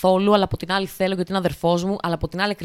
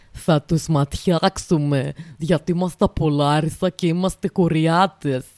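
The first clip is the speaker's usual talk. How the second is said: put-on slow, heavy Larissa country accent; 2 semitones lower, slower than usual. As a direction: drag it out like a proud local from Larissa. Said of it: "Δεν ξέρω γιατί έκανα αυτή την προφορά". (αργή, βαριά λαρισινή προφορά)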